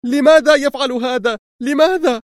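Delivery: very high-pitched distressed cry; screaming. A narrator's reading. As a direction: wail the question, pitch held right up. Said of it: "وهي تصرخ".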